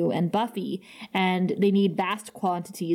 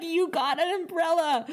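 higher, laughing replay of the line; gleeful; 8 semitones higher than usual. Retold or imitated. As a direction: giggle it out with glee again. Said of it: "the glee in which she said that".

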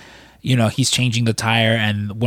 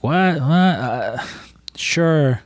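higher-pitched, confused questioning; mock bewilderment; 4 semitones higher, much slower than usual. Old George answers a visitor he can't remember, retold bewilderment. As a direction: imitate a puzzled man fumbling for an answer.

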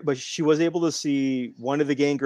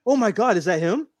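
much higher, louder thrilled gasp of recognition; can't believe who's coming out; excited disbelief; 8 semitones higher, 3 dB louder than usual. Retold or imitated. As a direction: fan spotting his hero's entrance, excited. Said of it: "it was like".

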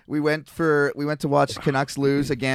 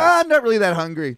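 mock casual excuse; 7 semitones higher, 6 dB louder, faster than usual. Cheap friend excuses himself.

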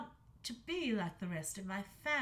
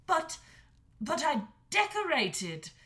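protesting; 7 semitones higher, 8 dB louder than usual. A narrator's reading. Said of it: "protested the dragon".

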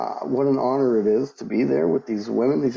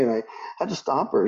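ordinary talk

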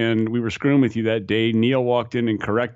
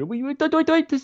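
high-pitched frantic babble, rising; mock mounting panic. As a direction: imitate a panicked student building up to a scream. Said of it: "it was building up".

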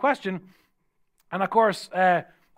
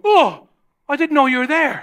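retold startled exclamation, higher and louder; mock surprise of a stranger just waking; 6 semitones higher, 6 dB louder than usual. Startled stranger waking beside him.

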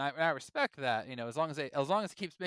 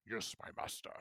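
servile henchman whisper; 7 semitones lower, 10 dB quieter than usual. Pretend. Servant voice answering master.

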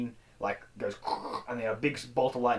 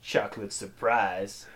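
dramatic last words; 5 semitones lower, 3 dB quieter, slower than usual. Acted. Acted last words at gunpoint.

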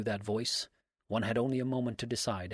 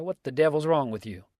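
louder and higher, an angry demand; angry outburst; 5 semitones higher, 9 dB louder than usual. A narrator's reading. Dix loses his temper at Abner, angry shout. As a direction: snap it at him, fed up.

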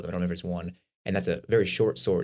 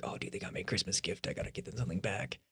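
soft whispered inner thought; whispered aside; 11 dB quieter than usual. Retold or imitated. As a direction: whisper it to yourself, a little panicked. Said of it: "I'm like".

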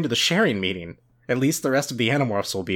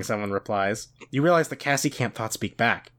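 usual speaking voice

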